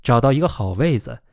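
usual speaking voice

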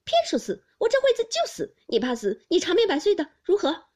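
high, loud, defiant outburst; defiant quarrelling. A narrator's reading. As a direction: hurl it back at him. Daiyu quarrelling, defiant replies.

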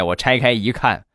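usual speaking voice

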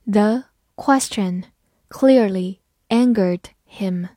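slow, word by word reading. Shown as a demonstration. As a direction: take the sentence slowly, word by word. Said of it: "好，慢读一遍".

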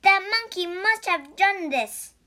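high, loud accusation; crying out. A narrator's reading. Priest blames the monkey for the tree, crying out. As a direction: exclaim it as an accusation. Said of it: "cried one priest".

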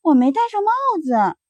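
lightly voiced child's excuse; child making excuses. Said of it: "第二个孩子说".

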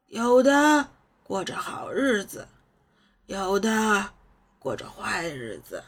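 put-on slow, wistful old man; slow reflective old mentor; wise old sage. A narrator's reading. Old master voiced in story.

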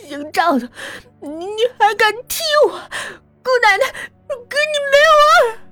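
high, tearful angry cursing, slowing at the end; furious cursing. Acted. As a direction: scold him, crying with rage. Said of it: "坐在地上骂了一句". (哭着破口大骂)

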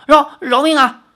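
高声惊恐地求饶; 结结巴巴地喊饶命; 求饶叫喊. Acted. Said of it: "只好叫道". (惊恐地大声求饶)

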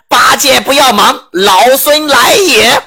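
high, slow heroic call; Monkey King calling down to Bajie, high and drawn out; shout from the sky. Acted. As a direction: announce your arrival from above. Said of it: "孙悟空突然在空中高喊一声".

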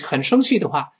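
ordinary talk, nothing marked

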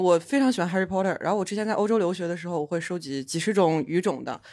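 ordinary talk, nothing marked

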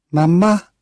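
put-on high rising child's call. A narrator's reading. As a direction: call out to her mother. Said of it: "she called".

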